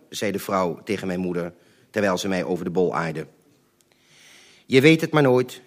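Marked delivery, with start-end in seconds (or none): 4.7-5.6 firm and louder, every word set down; mother standing her ground, no doubt in her voice; determined. Retold or imitated. Mother confronts the neighbours, firm and determined. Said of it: "nog nooit zo vastberaden gehoord".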